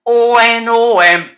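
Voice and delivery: slow, loud letter by letter; spelled out loud. Shown as a demonstration. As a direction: call each letter for the class to echo. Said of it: "I'll say it first and you repeat it".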